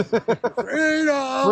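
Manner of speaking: high, drawn-out battle-cry yell; playful scream. Shown as a demonstration. Interviewee cheering the opt-out's freedom to the interviewer, playful scream. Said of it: "I'm gonna scream it like".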